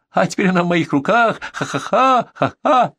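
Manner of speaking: high, crazed gloating with acted cackle; gloating yell. A narrator's reading. Bandit gloats over his hostage, high and cackling. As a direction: crow it high, ending in a mad ha-ha. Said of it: "орал бандит".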